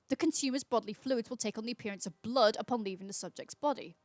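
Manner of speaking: ordinary delivery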